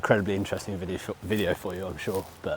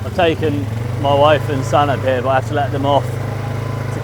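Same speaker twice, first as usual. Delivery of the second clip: shouting over engine; 5 semitones higher, 7 dB louder than usual. Real, not acted. Riding bike over engine noise.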